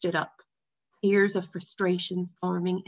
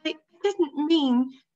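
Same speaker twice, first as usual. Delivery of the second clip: put-on hesitant boy's voice, slow and higher; a boy trying to explain, slowly; young boy voice; 8 semitones higher, 4 dB louder, slower than usual. A narrator's reading. Boy protesting to his father, halting and higher.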